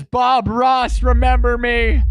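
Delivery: high, loud eager call; excited fan call. Retold or imitated. Viewer calling to Bob Ross, high and loud eager fan call. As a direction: call out hoping to be noticed. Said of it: "Magic Hub says".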